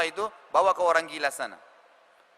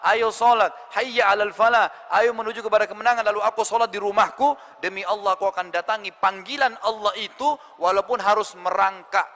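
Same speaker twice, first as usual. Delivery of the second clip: emphatic, ringing declaration; firm and clear; 4 semitones higher, 3 dB louder than usual. Acted. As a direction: declare your resolve to your student, every word clear. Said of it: "dengan tegas dan jelas".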